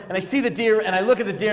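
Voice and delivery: ordinary delivery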